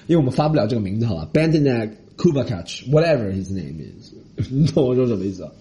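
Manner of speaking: ordinary talk, nothing marked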